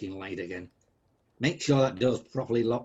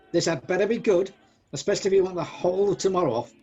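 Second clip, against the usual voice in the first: raised pitch, quickening into a threat; irritable warning; 6 semitones higher, 4 dB louder, faster than usual. Acted. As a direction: snap impatiently at the clerk. Scrooge threatens clerk wanting time off.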